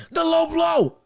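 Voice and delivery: sharp high shout at a foul; loud shout. Real, not acted. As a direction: yell it out in protest. Angry call of low blow.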